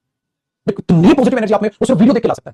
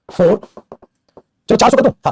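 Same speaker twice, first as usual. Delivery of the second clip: ordinary talk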